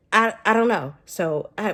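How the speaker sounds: ordinary talk, nothing marked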